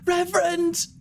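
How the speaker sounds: very high, breathy falsetto call; high breathy woman calling for help. Acted. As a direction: cry out breathily, far above your own pitch. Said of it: "a breathy voice going".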